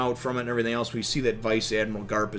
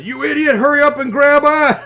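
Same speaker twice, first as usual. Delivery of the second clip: high, louder crying yell; tearful shouting Luffy; 13 semitones higher, 11 dB louder than usual. Retold or imitated. Luffy crying at Usopp to grab on, high tearful yell. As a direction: yell through tears, reaching out. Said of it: "Luffy's crying his eyes out".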